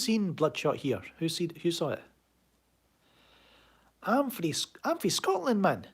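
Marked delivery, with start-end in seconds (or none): 4.0-5.9 high, hammed-up broad Scots; broad Scottish accent. Shown as a demonstration. Streamer tells viewers where he is from, broad high Scottish accent. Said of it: "Aye, that's my accent".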